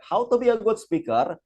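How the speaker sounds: announcer voice. Acted. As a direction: call out the heading like an MC on stage. Announces a slide heading again. (nada MC yang lantang)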